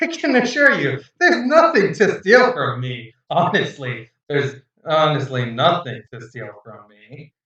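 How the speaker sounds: uneasy chuckle through the denial; a captain laughing off the thief; nervous laughing. Acted.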